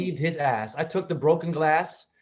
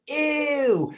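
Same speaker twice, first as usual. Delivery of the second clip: high squeamish ew; hammed-up grossed-out brother; mock disgust; 12 semitones higher, 3 dB louder than usual. Brother finding doo-doo on his carpet, high disgusted.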